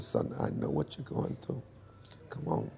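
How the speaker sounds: soft, near-whispered comfort from mom; imitating mom's hushed reassurance; hushed mother. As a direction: hush him gently like a worried mother. Mother comforting her sick son.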